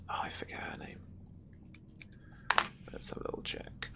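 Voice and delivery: very quiet, near-whispered aside; hushed, half-voiced thinking aloud; muttered aside. Real, not acted. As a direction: mutter to yourself while checking notes. Aside while looking up a name.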